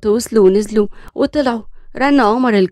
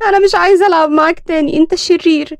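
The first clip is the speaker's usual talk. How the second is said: put-on crying child; 6 semitones higher, 4 dB louder than usual. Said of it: "بيسان قعدت تعيط".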